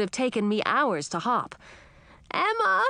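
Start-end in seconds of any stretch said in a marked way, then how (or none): 2.3-2.8 high urgent shout of her name. A narrator's reading. Edric shouting a warning to Emma, high shout. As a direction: call her name out in alarm. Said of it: "shouted Edric".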